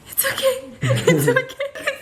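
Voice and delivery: 당황한 채 달래는 약혼자 흉내; high, slow, panicked "it's okay"; alarmed reassuring fiance. Retold or imitated. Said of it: "he goes".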